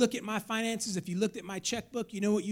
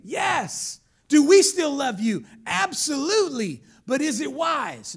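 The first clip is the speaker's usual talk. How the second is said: loud, raised, emphatic sermon delivery; full-force preaching; 4 semitones higher, 8 dB louder than usual. Real, not acted. Preacher urging congregation to choose wisdom, full force. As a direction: drive every phrase out to the back pews.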